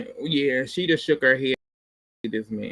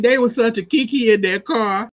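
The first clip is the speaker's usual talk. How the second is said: excited; 6 semitones higher, 6 dB louder than usual. Real, not acted. Delighted recap of the fight.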